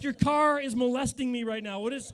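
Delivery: shocked loud complaint; alarmed yell. Retold or imitated. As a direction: yell at the car feeling groped. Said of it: "I'm like".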